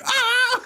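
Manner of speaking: very high, wordless shriek; piercingly high retold yell; mock scream. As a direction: imitate frantic screaming, breaking into laughter. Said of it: "he's just screaming".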